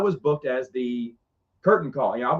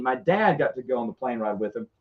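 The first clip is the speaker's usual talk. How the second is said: ordinary delivery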